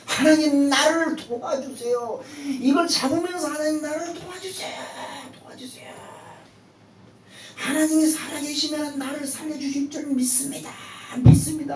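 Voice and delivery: high, loud cry to God; desperate cry. Acted. Young man clinging to a branch screams his prayer to God. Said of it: "절규합니다".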